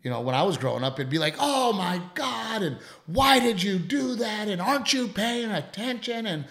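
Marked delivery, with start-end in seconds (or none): 1.4-6.4 high, drawn-out mock scolding; parents losing it. Imitating parents losing it over spilled milk, mock exasperated scolding. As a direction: stretch each rebuke, exasperated and high-pitched. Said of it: "my parents would lose their shit".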